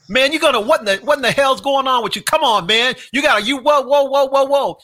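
mock yelling teammates. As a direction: rile him up like an angry teammate. Braves yelling at Eddie on bench.

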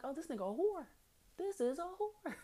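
high, incredulous thought; high-pitched stunned aside; shocked inner voice. Retold or imitated. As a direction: gasp it out in disbelief.

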